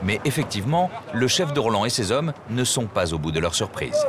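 ordinary talk, nothing marked

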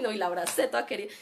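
ordinary talk

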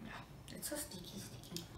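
breathy whisper, almost no voice. Real, not acted. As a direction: murmur it under your breath. Fiddling with sticky candy wrapper.

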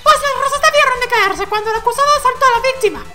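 very high, loud retort; heated objector. Acted. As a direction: fire back in a squeaky, shouted character voice. Personaje replica en el juicio. (réplica airada, muy aguda y fuerte)

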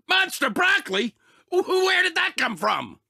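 startled outburst. Acted. Monster broccoli appears suddenly.

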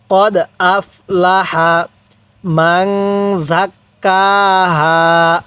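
কদ আফলাহা read slowly with জাক্কাহা's doubled k held; slow recitation demo. Shown as a demonstration. Teacher demonstrates the verse slowly for learners. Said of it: "তাহলে কিভাবে পড়ব?".

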